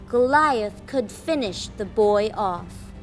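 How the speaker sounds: ordinary delivery, nothing marked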